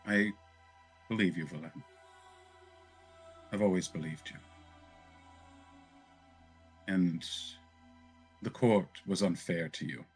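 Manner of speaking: a lightly acted consoling noblewoman; measured reassurance, with long pauses; lightly voiced Zadali, consoling. Zadali comforts the disgraced Valen, lightly voiced and consoling.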